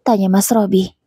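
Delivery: ordinary delivery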